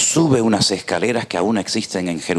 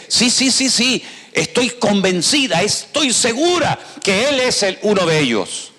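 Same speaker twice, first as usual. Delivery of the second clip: put-on insistent girl; 13 semitones higher than usual. Girl insists Peter is a disciple.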